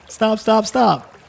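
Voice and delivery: high laughing plea to stop; high pleading voice. Acted. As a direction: beg them to stop, half laughing. Acted overwhelmed plea.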